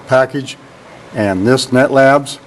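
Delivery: ordinary talk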